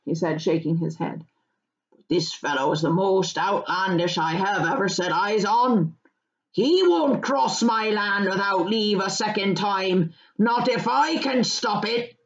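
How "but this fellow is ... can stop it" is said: firm, rustic indignation; country farmer, indignant. Acted. Farmer telling of an outlandish stranger, indignant. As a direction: grumble about a trespasser in a farmer's voice.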